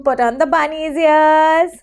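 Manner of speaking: usual speaking voice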